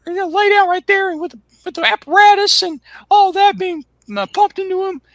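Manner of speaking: high, squeaky Cornette voice; Cornette impression. Bruce's friend on the phone, in a high squeaky whine. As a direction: squeal it out like a frantic southern manager.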